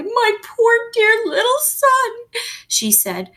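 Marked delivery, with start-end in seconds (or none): 0.0-2.1 high, slow, weepy mother voice; tearful mother. A narrator's reading. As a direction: lament over her lost son. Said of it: "Missus Little began to cry".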